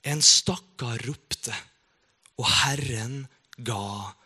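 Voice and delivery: slow, solemn Bible reading with long pauses; a preacher reading a psalm to the congregation, slow and solemn; solemn scripture reading. Acted. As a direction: read the psalm slowly and reverently, letting each line land. Reads the psalm aloud.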